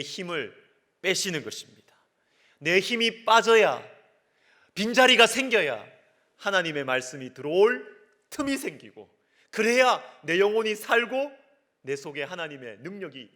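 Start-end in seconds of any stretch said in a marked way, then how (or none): 2.6-11.4 high, loud, insistent exhortation; full-force preaching. Acted. Preacher urging the congregation to make room for God, full-force. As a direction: drive each phrase home to the congregation.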